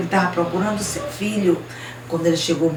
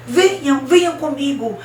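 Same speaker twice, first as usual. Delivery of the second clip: high, raised summons; urgent call; 7 semitones higher, 4 dB louder than usual. Acted. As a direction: call them to follow at once. Boy urgently calling them along. (chamado urgente, agudo)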